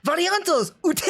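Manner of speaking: high, punchy call with heavy emphasis; imitating the Japanese voice actress; emphatic Japanese command. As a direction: hit ute hard like a battle order. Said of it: "the way that Notaro's voice actress says it".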